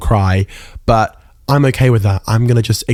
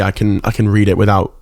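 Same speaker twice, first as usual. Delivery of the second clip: usual speaking voice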